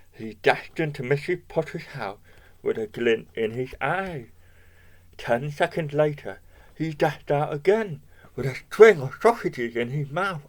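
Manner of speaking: ordinary delivery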